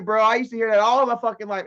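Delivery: ordinary talk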